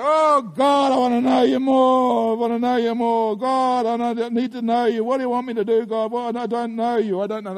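loud, high pleading to God; desperate prayer. Retold or imitated. Pastor reenacting his prayer at home, loud plea. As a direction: beg God to be known. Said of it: "you're like".

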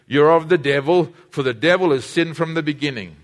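ordinary talk, nothing marked

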